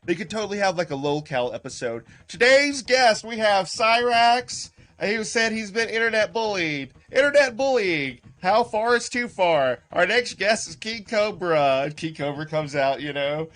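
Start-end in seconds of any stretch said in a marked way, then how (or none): 2.3-11.8 mock TV host intro, higher and louder; talk show host. Streamer playing Springer introducing internet guests, mock host voice.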